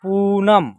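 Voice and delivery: पूनम as one word with a long ू; teacher sounding out पूनम whole; joined, drawn-out reading. Shown as a demonstration. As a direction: stretch the first vowel of पूनम. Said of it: "एक साथ उच्चारण होगा इसका".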